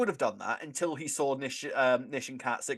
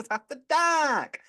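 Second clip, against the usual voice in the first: shouted catchphrase; 13 semitones higher, 5 dB louder, much slower than usual. Pretend. Show catchphrase called out again.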